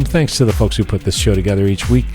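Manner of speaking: usual speaking voice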